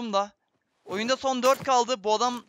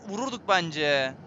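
ordinary talk, nothing marked

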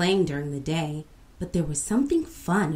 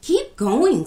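higher, louder urging; eager; 8 semitones higher, 7 dB louder than usual. A narrator's reading. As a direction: spur the teller on. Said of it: "said Amma".

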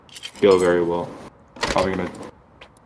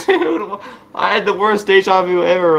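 laughing; 8 semitones higher than usual. Real, not acted. After escaping the bear.

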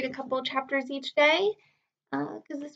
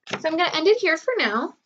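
usual speaking voice

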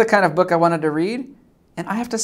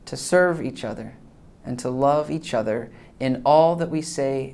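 prayer; 5 semitones lower than usual. Acted. Leads a family prayer.

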